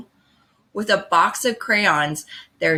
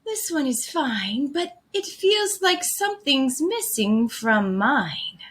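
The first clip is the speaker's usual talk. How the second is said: put-on higher, gentle girl's voice; Callie; 6 semitones higher than usual. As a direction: voice her lightly up high, politely unsure. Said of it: "Callie said".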